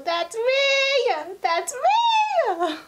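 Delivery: loud, very high-pitched fan shriek; excited squeal. Real, not acted. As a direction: squeal it out, stretching "me". Hears her own name in the video.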